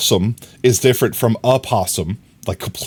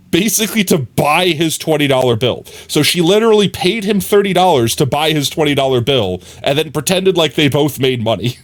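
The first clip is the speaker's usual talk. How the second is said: normal talking voice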